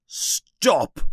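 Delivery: high, sudden protest; panicky-uncle outburst without much volume; understated yell. A narrator's reading. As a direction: cut in with a high cry. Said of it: "yells Uncle Vernon".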